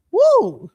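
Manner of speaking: loud high whoop with a laugh. Real, not acted. Blowing off steam to viewers after a rant, laughing whoop. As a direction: let out a laughing woo.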